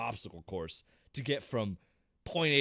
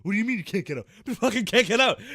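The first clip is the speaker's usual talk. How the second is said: high, louder building yell; panicked yelling; 8 semitones higher, 8 dB louder, faster than usual. Retold or imitated. Stuck in the truck with zombies, rising shout. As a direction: escalate to a shout. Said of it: "we're like".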